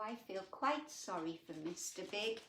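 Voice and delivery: usual speaking voice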